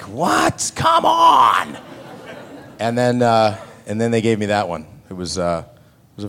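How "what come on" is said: high, loud exasperated shout; frustrated outcry. Retold or imitated. Actor venting at yet another rejection, shouting. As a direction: shout in disbelief.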